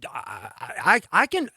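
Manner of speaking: ordinary delivery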